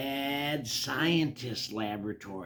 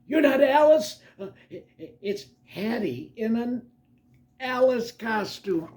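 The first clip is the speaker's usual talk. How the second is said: high, loud, then stammering; high-pitched startled stammer; startled scream; 10 semitones higher, 6 dB louder than usual. Acted. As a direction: cry out in surprise, then falter. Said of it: "He screams".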